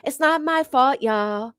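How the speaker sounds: slow, clueless mock-innocent voice; lightly voiced innocent airhead act; a hint of a dumb victim, slow; playing dumb. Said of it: "playing that stupid, duncy".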